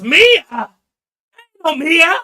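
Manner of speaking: shouted high and loud, furious recognition; angry outburst. Pretend. Anger in the mother's head recognising Mia, furious shout. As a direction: yell the name like Anger bursting out. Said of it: "Anger goes".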